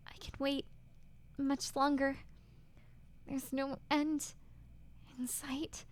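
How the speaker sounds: despairing heroine. Acted. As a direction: ask it broken, close to tears.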